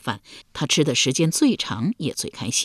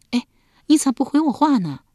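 high, insistent wife's voice; a wife nagging her husband for an answer; 9 semitones higher, 3 dB louder than usual. Acted. Wife pressing her silent husband at night, high and insistent.

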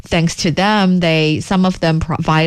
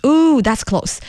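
ordinary talk, nothing marked

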